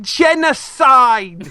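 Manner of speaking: high, drawn-out exclamation; stretched, high comic cry; mock outburst. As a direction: burst out with it slowly and high after stammering. Stammers, then bursts out the word.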